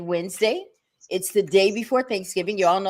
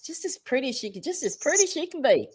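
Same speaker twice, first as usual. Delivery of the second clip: put-on Southern accent; 7 semitones higher, 4 dB quieter, faster than usual. Host praising her guest in a Southern accent. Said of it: "That was my Southern accent".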